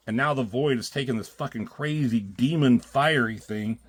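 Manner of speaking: usual speaking voice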